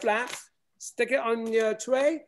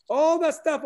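normal talking voice

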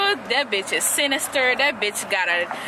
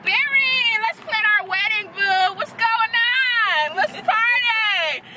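mocking cheerful Iris; 9 semitones higher, slower than usual. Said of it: "Two episodes left".

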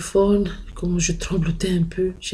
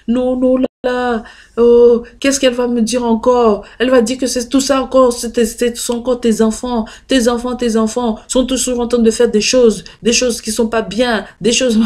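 higher, louder fretful complaint; mimicked panicking mother; 6 semitones higher, 7 dB louder than usual. Mother dreading the grandmother's reproach, higher and louder. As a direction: fret aloud like a worried mother.

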